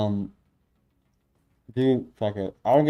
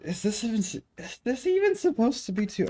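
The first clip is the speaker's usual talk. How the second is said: exasperated disbelief; 11 semitones higher than usual. Real, not acted. Exasperated at code.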